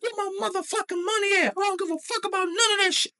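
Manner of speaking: very high-pitched, fast ranting; acted-out screeching rage; furious demand. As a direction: rant at the top of your range. Acted outburst at manager.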